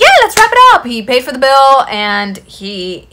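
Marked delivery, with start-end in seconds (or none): 0.0-0.8 much higher and louder, overeager chirp; eager mock date. Date eagerly agreeing to leave the restaurant, high loud chirp. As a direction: chirp it like you can't wait to go.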